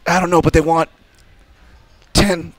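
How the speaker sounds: high mock bafflement, halting then blurted loud; Wild Bill flummoxed. Wild Bill misreading the ransom note, high halting mock panic. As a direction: stall on the number, then yelp it out in imitation. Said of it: "Wild Bill couldn't read".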